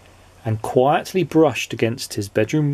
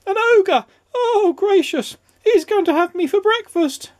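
wailing, very high hysteria; terrified scream; 3 dB louder than usual. A narrator's reading. Mister Jelly fearing an ogre, terrified scream. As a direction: squeal and wail in cartoonish terror. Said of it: "he screamed".

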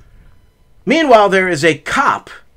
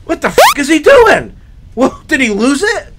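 high-pitched baffled exclamation; confused disbelief; 7 semitones higher, 3 dB louder than usual. Retold or imitated. Friend watching his pal chase a car, confused. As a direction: imitate a bewildered onlooker. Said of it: "I was so confused".

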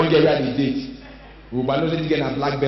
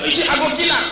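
fake tough shout; 9 semitones higher than usual. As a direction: bluster as if restrained. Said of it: "dey ad lib".

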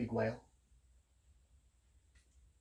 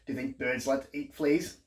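ordinary delivery, nothing marked

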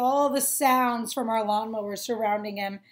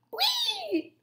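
squeaky high whee; 4 dB quieter than usual. Acted. Sound word read in story.

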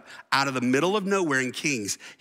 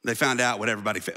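ordinary talk

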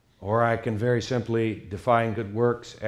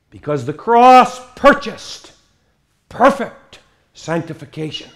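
loud, ringing pulpit delivery with a raised pitch; forceful preaching; 9 semitones higher, 9 dB louder than usual. Acted. Preacher booming to his congregation, forceful preaching. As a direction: proclaim it to the whole church.

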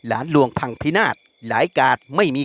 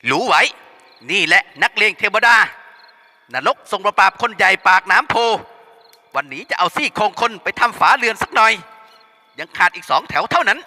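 boasting thug; 6 semitones higher than usual. Acted. Thug bragging about his power to scare everyone. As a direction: puff up and threaten. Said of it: "ประกาศศักดาอีก".

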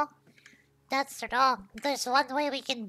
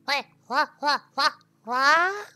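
rapid-fire disbelief, rising louder at the end; incredulous; 6 dB louder than usual. Real, not acted. Player baffled by the chemistry game, incredulous. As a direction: stutter it out, ending on a louder, rising yelp.

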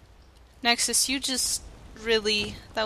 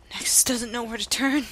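ordinary talk

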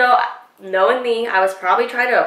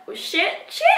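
alarmed; 8 semitones higher, 5 dB quieter than usual. Retold or imitated. Retold alarm as he approaches.

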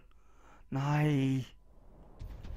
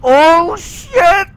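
loud, high cry of alarm; real startled yell; panicked shout; 15 dB louder than usual. Real, not acted. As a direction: yelp it in sudden panic. Panic at game danger.

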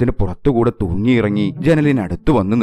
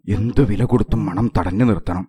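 ordinary delivery, nothing marked

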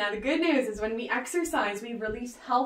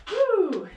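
a high, loud whoop of encouragement; excited whoop; 10 semitones higher, 5 dB louder than usual. Real, not acted. Fitness coach whooping to keep the class going, excited. Coach cheering mid-workout.

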